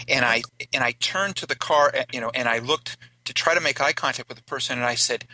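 normal talking voice

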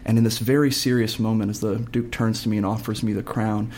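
usual speaking voice

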